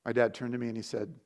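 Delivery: ordinary delivery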